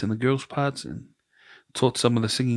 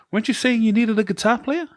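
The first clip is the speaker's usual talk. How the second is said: much higher voice, rising question; retold eager question; 12 semitones higher than usual. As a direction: imitate a friend piping up to his wife.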